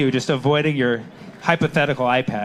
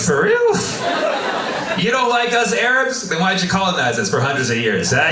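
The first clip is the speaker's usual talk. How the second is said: mock outraged surprise; 7 semitones higher than usual. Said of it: "I'm like".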